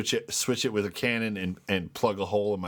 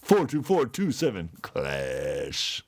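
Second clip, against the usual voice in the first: very slow, stretching out "clash"; slow showy read with a long "clash"; drawn-out announcement; 3 semitones lower, much slower than usual. Pretend. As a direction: ham up the number, dragging "clash" right out. Asked again, hams up the number.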